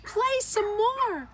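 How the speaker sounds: high, slow pleading; begging. A narrator's reading. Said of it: "Geraldine begged".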